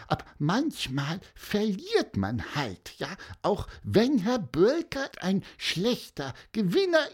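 high, squeaky little-bird voice; halting, earnest comfort in a small high voice; peeping creature, consoling. A narrator's reading. Pieper consoles the boy after losing, squeaky consoling.

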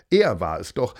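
usual speaking voice

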